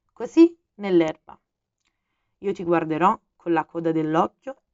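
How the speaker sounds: the fox asking earnestly to be tamed; fox, lightly voiced. A narrator's reading.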